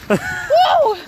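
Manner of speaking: excited cheer. Real, not acted. Cheering pasta success.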